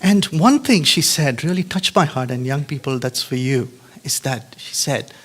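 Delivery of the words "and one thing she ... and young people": ordinary delivery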